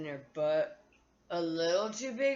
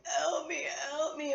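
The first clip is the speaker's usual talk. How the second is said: pleading; 8 semitones higher than usual. Retold or imitated. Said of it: "I'm like".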